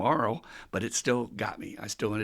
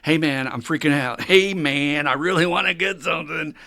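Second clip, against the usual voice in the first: urgent call for help; 4 semitones higher, 7 dB louder than usual. Retold or imitated. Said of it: "call my sponsor and say".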